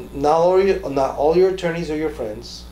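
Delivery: normal talking voice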